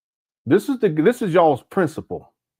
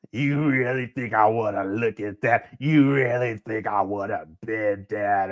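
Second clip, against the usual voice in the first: mimicking Umar; 5 semitones lower, 3 dB quieter than usual. Mocking the ranting principal.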